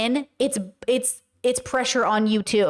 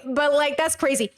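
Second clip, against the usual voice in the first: usual speaking voice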